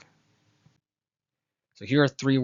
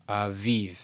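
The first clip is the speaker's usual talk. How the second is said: aviv slowly as ah-VEEV; stress on marked vowel. Shown as a demonstration. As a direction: land on the second syllable of aviv. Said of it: "the emphasis is on this vowel".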